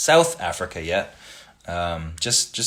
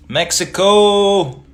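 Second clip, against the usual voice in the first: drawn-out Mexico; 11 semitones higher, 10 dB louder, much slower than usual. Real, not acted. Reading a comment, drawn out.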